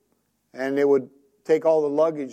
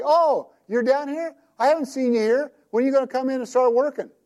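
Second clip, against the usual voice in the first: much higher, sing-song nosy voice; mock nosy acquaintance; 9 semitones higher than usual. Wife mimicking a nosy fan spotting him, high and mocking. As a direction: ham up a busybody. Imagined onlooker quoted.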